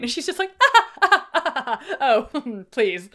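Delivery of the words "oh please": laughing dismissal. Retold or imitated. Emma waving off Knightley's doubts, laughing. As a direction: laugh the words out, brushing it off.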